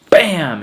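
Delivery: very loud, high bam like a slammed door; teacher slams the door, loud mimed bam. Retold or imitated.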